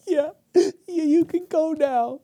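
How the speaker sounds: defeated Pharaoh. Retold or imitated. Uncle voicing Pharaoh letting them go, whiny. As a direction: give in like a beaten king.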